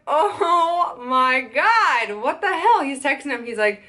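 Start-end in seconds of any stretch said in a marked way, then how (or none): 0.1-2.8 drawn-out, rising mock disbelief; retold exasperation. Reacting to the photographer's no-show, retold exasperation. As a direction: stretch it out slowly, then pitch up in exasperation.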